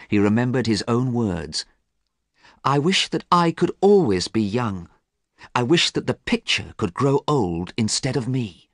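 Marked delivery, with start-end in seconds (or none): 2.6-8.7 lightly voiced young man wishing hard, higher pitch; earnest, higher-voiced longing; Dorian's fervent wish. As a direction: wish aloud with raised pitch and growing urgency. Narrator voices Dorian's remembered wish.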